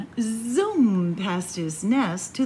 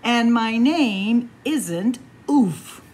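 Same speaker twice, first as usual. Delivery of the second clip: louder and slow, each word firm; insistent; 6 dB louder, slower than usual. Acted. Warren correcting the animals, louder and slow. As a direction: put your foot down on the name.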